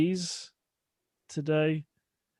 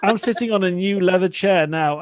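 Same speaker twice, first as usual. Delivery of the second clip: words spilling out through real laughter, louder; laughing while talking; 4 semitones higher, 6 dB louder than usual. Real, not acted. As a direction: laugh your way through the line. Laughing about her sweaty leather chair.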